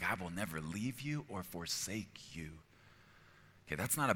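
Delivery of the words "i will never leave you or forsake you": whispered, tender promise; God as loving owner. Acted. God making a promise to his children, whispered reassurance. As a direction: promise it softly, almost without voice. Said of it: "He makes statements like".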